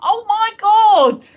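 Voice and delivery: high, loud and slow burst of delight; delighted shout. Real, not acted. Co-host reacting to the tankard with loud delight. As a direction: gasp out real delight at the tankard.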